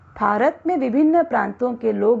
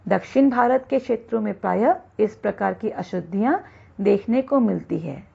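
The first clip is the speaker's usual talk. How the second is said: normal talking voice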